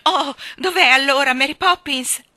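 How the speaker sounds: put-on worried child calling out; anxious cry. A narrator's reading. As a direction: cry out the question in alarm. Children find Mary Poppins missing.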